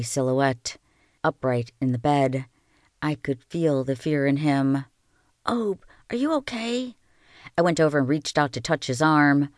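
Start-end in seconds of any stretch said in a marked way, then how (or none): 5.4-7.0 high, hushed worried question. Acted. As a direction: ask gently, afraid of the answer. Frightened girl checks on brother.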